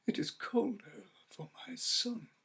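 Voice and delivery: high, tearful plea of a grieving mother; weeping mother. Acted. Grieving mother answers her husband through tears. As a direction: let the grief catch in her voice.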